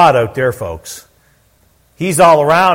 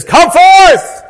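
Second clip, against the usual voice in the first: high, full-voiced shout; loud command; 8 dB louder than usual. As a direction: read it like he spoke loudly. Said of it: "let's read it like he spoke loudly".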